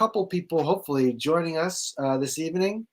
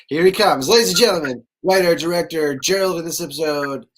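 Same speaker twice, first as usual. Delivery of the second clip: louder, showman-style build to the name; big host introduction, landing on the name; announcer intro; 5 dB louder than usual. Pretend. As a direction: present him like a stage emcee. Introducing guest like a show host.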